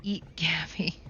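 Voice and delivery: soft sneaky whisper; mischievous whispered command. Pretend. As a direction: breathe it like a guilty secret.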